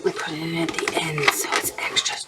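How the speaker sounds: soft half-whispered aside; whispering. Real, not acted. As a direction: say it under your breath. Quiet aside while cooking.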